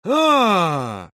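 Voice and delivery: long, high falling "ah"; cry of recognition. A narrator's reading. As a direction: let out a drawn-out aha of sudden recall. Женя suddenly remembers. (протяжное «А!» узнавания)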